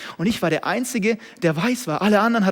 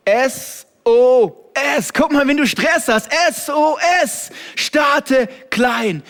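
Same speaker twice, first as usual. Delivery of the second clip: loud, high, slow hammered sermon points; a preacher drilling a slogan at full volume; full-force preaching; 8 semitones higher, 8 dB louder, slower than usual. Acted. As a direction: shout each point to the congregation.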